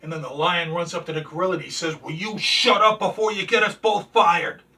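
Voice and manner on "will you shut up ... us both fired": loud, raised-pitch exasperated order; mock angry scolding. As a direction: shut him up with a comic outburst. Lion scolds the screaming gorilla.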